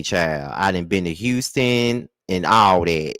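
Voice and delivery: usual speaking voice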